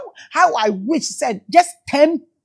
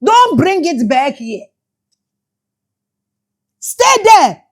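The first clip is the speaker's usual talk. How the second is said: shouted command; 2 semitones higher, 3 dB louder, slower than usual. Real, not acted. Speaker repeating Traore's order, shouting it. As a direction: throw it out like a command. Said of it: "This is why I am shouting".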